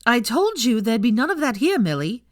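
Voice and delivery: stern. A narrator's reading. Pansy to Millie, loud stern warning. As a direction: lay down the law. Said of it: "said Pansy sternly".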